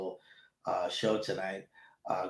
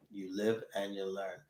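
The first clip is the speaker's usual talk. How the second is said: softer repeat to himself; soft echo; 3 semitones lower, 8 dB quieter than usual. Real, not acted. Host echoing his lesson to himself, a softer resigned repeat. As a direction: mutter it back as a resigned afterthought.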